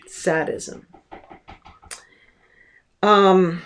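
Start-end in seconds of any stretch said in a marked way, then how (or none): 0.1-0.9 "Sadism" said softly with a short A, SAD-izm; correct pronunciation. Shown as a demonstration. Lecturer repeating the correct form, "Sadism" softly as SAD-izm. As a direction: quietly restate "Sadism" beginning like "sad". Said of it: "the correct pronunciation of that word".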